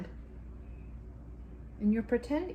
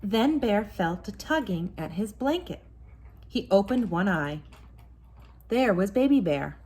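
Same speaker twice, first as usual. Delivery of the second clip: story narration; 3 semitones lower, 4 dB louder than usual. A narrator's reading. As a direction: tell it like a bedtime story to a child. Mother reads bedtime story.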